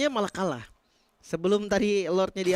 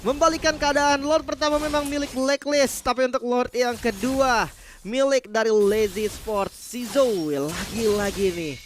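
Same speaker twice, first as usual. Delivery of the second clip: loud drawn-out hype call; esports caster; 5 semitones higher, 6 dB louder, slower than usual. Real, not acted. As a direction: stretch the words, shouting.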